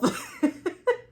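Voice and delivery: high burst of laughter. Real, not acted. Laughing at her own story of cussing, loud and high.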